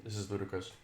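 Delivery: ordinary talk, nothing marked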